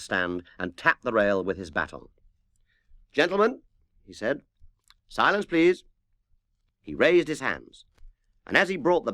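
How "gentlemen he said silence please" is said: higher, louder call to order; calling for silence. Acted. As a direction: rap the orchestra to attention. Conductor calls orchestra to order.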